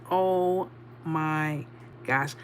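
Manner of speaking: very slow, low re-enacted dismay; embarrassed. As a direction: draw each word out, mortified. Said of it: "I was so embarrassed".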